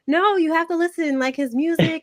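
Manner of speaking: acting out eager persuasion, pitched high; insistent hype. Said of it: "And I'm like".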